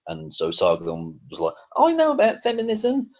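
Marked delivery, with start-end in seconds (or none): 1.8-3.1 much higher, squeaky mock of a smug boast; silly mocking voice. Vlogger mocking Sargon's boast about feminism, in a silly high voice. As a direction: parody his boast in a ridiculous high voice. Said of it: "I don't know why I said it in that voice".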